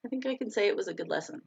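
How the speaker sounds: normal talking voice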